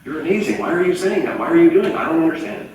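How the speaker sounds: high-pitched loving protest; exasperated disbelief. Acted. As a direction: plead as if shaking a friend to their senses. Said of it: "just shake them and be like".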